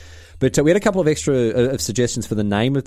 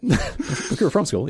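laughing; 3 semitones higher, slower than usual. Real, not acted.

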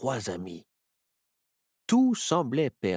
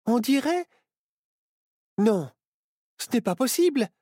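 high, loud panicked cry; high-pitched panic as the girl realises they are trapped; 4 dB louder than usual. A narrator's reading. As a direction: Myrtille freaking out in a high voice. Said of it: "s'affola Myrtille".

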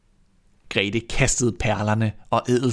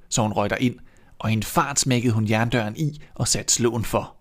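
usual speaking voice

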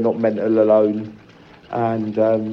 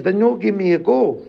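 lightly voiced elderly mum, higher; imitating mum; 8 semitones higher than usual. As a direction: mimic mum's little complaint, pitched up.

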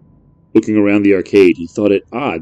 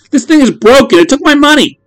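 put-on high, loud complaining kid; a kid whining about lost money; upset boy; 7 dB louder than usual.

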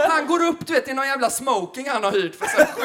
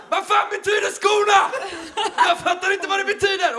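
high, loud desperate yelling, half hammed-up; panicked shout; 10 semitones higher, 3 dB louder than usual.

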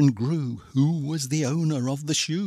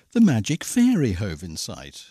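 ordinary delivery, nothing marked

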